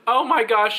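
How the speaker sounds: high, imitated burst of amazement; mock-astonished exclamation; amused disbelief. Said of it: "the lady starts laughing in front of me".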